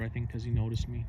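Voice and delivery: ordinary talk, nothing marked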